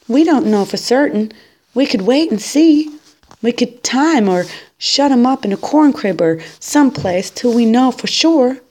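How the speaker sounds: high, loud pleading; desperate bargaining. A narrator's reading. Boy begging Mama to wait and see, high and loud. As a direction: plead for any other way.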